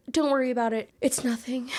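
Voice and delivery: high-pitched mock nonchalance; imitating an airy dismissal; playing it off. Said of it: "Lakin plays it off".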